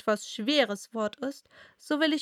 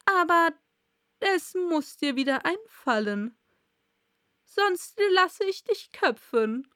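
higher, measured royal threat; drohender König; 7 semitones higher, 4 dB louder than usual. Acted. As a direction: warn the witness as an imperious king. King threatens the Hatter. (drohender König, mit erhobener Stimme)

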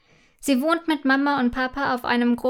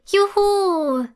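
high, loud whoop of joy; cheering; 8 semitones higher, 4 dB louder than usual. A narrator's reading. Girl finally allowed to see the surprise, cheering. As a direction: cheer it out, much higher and louder. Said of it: "jubelt Imme".

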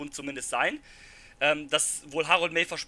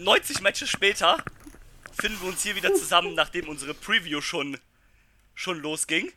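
normal talking voice

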